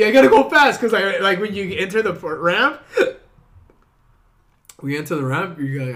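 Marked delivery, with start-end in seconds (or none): 0.0-0.8 high, loud burst of panic; mock panicked shout. Friend reliving first freeway drive, loud panicked shout. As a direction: yell it like you're merging in a panic. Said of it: "'cause you feel like".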